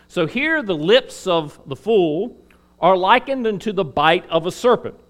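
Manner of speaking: normal talking voice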